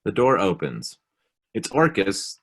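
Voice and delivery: ordinary talk, nothing marked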